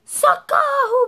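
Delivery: very high, loud, slow cry for help; terrified cry. Acted. Fox sees the gruffalo and screams for help. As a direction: scream it as you bolt.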